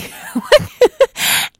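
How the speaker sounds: high, loud burst of laughter; a real delighted laugh breaks out. Real, not acted. Laughing about how the guys reacted to the photo.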